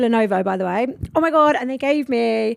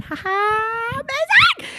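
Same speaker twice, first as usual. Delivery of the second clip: sarcastic cheer; 12 semitones higher, much slower than usual. Retold or imitated.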